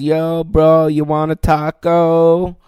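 high, loud, slow bro voice stretched out; drunk dude. Retold or imitated. Drunk dude yelling at construction workers, loud high bro voice. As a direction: ham up a drunk bro heckling from a car.

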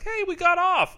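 high, loud cheerful mock quote; gleeful coworker. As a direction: sing out the good news. Coworkers gleeful at covid leave.

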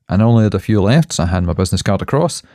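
usual speaking voice

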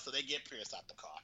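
normal talking voice

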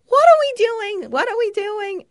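high, loud imitation of Adam's outrage; outraged rant. Retelling Adam ranting about silly string at the carnival, in mock outrage. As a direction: throw up your hands in mock disbelief. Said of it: "He's like".

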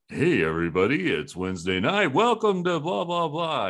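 upbeat radio DJ greeting; lightly voiced smooth announcer; warm announcer. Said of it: "I thought he'd be like".

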